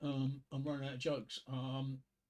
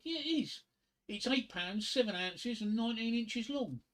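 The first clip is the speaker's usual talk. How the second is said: proud punchline; 7 semitones higher than usual. Acted. Husband's proud punchline reply.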